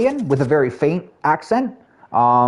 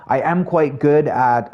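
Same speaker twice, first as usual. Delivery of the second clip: normal talking voice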